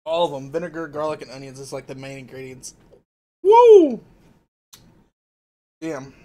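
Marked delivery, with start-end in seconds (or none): 3.4-4.0 sharp high yelp of heat; loud high woo. Real, not acted. Reacting to hot sauce.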